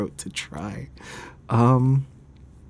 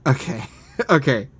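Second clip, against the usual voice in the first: ordinary delivery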